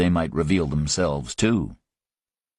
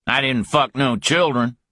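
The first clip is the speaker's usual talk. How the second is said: higher, heated denial; angry retort; 6 semitones higher than usual. Acted. Griffin snapping at Parker, higher and heated. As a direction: bite back hard.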